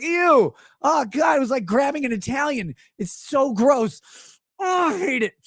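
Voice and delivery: high-pitched hammed-up revulsion; grossed-out whine; disgusted. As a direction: recoil in mock disgust, voice jumping up. Acting out grabbing buttery dish.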